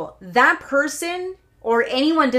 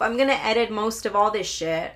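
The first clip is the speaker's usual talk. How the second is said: ordinary delivery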